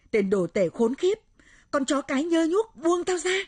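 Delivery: loud rage, climbing high; enraged yell to be released; furious cursing shout. Acted. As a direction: spit out insults, building to a scream. Possessed friend cursing, demanding release.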